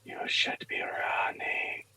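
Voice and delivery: slow, eerie voiceless whisper. Acted. Pendant warns its wearer in the fog, slow whisper. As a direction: breathe out a ghostly warning, slowly. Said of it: "you hear it whisper".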